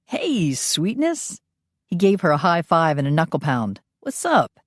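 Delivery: camp, bright greeting to a pal; sassy Phineas. Acted. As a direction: tease her like a cheeky friend. Phineas, flamboyant friend.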